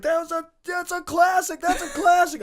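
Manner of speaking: high-pitched distraught shouting; freaking out. Retold or imitated. Rider seeing his smashed moped, high frantic protest. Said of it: "I'm freaking out".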